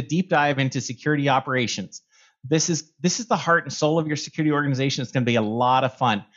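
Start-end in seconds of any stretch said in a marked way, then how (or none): 2.5-6.2 quick, excited rush of words; talking fast. Real, not acted. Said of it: "I'm sorry I'm talking fast".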